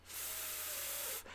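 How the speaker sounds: long F sound with no voice; sustained unvoiced fff; voiceless F. Shown as a demonstration. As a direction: hold a hissed F, breath only. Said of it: "except you take out the voice".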